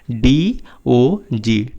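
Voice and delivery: very slow letter by letter spelling. Shown as a demonstration. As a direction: stretch each letter for the kids. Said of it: "teacher spells word slowly". (बहुत धीरे-धीरे spelling)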